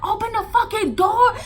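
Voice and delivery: high, loud furious demand; angry yell. Retold or imitated. Mama at the girls' door, a high angry yell. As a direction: bang out the order.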